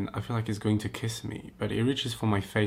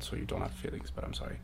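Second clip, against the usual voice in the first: softer, unvoiced whisper; whispered aside; 13 dB quieter, faster than usual. Real, not acted. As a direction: whisper an apology.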